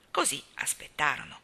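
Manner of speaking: ordinary delivery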